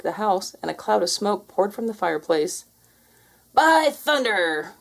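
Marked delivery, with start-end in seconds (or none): 3.5-4.7 slow, booming pirate ghost. Acted. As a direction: boom it like a ghostly captain. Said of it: "boomed a mighty voice".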